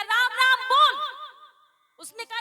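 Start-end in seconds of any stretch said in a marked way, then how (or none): 0.0-1.0 sharply raised, loud order; loud command. Acted. Saint loudly ordering the dying man to say Ram Ram. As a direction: bark it at him to say the name.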